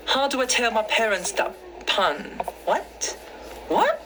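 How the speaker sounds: high-pitched, hammed-up British accent. Shown as a demonstration. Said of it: "Do British accent". (pabirong British accent na mataas ang boses)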